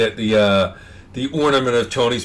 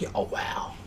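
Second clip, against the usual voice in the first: whispered; 14 dB quieter than usual. Retold or imitated. Quoted reaction to a gift.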